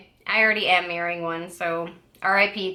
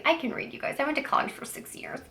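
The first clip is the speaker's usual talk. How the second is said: whisper; 6 semitones higher, 8 dB quieter, faster than usual. Real, not acted. Joking aside after mispronouncing.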